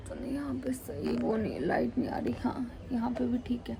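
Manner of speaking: whispered aside while fixing the light; real whisper. Real, not acted. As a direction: whisper it to yourself, barely voiced. (रोशनी ठीक करते हुए धीमी फुसफुसाहट)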